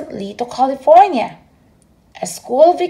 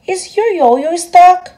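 mocking policeman; 8 semitones higher, 5 dB louder, slower than usual.

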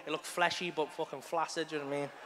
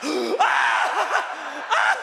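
sharp gasp, then loud high yelps; hammed-up howls of sudden groin pain; agonised scream; 8 semitones higher, 7 dB louder than usual. Acting out agony on the stairs.

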